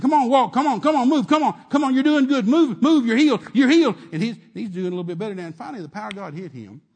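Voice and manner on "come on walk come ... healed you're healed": loud, higher coaxing shouts. Retold or imitated. Preacher coaxing the man step by step, loud. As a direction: cheer him on with force. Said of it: "I'm just saying".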